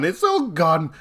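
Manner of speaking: a high, drawn-out little wail over the loss; mock despair. Player telling of losing everything with his friend, mock wail. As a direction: voice leaping up high on "all".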